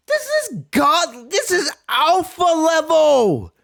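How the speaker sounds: slow, high awestruck voice; drawn out in awe; awed hype. Real, not acted. Reactor marvelling at Goku's power, slow high awe.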